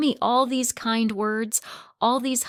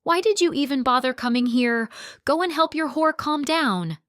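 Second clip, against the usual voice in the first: louder, higher furious yell; 8 semitones higher, 5 dB louder than usual. Acted. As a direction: hurl it at him with full rage. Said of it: "I yelled furiously".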